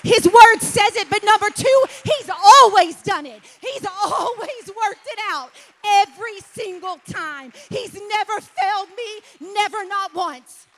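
loud, high preaching at full force. Acted. Preacher rousing the congregation, loud and high. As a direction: proclaim it to the congregation.

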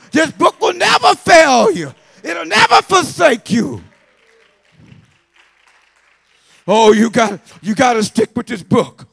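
loud, high, slow preaching shout; preaching at full force. Acted. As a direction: thunder it from the pulpit, stretching every word. Preacher winds up to a climax.

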